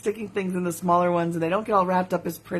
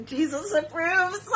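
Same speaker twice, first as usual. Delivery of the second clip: higher, slow mock blessing; mock solemn; 8 semitones higher, 3 dB quieter, slower than usual. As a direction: joke it like a sermon. Joking aside.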